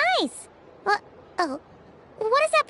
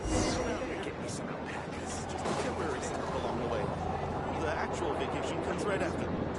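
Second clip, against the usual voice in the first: long weary sigh; 12 dB quieter than usual. Acted. Long sigh before complaint.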